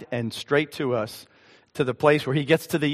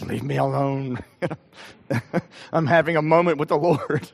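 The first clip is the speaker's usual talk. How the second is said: chuckling through the retold words; amused, laughter breaking in; laughing retelling. Real, not acted. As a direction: laugh your way through a mock protest. Laughing while retelling his weeping.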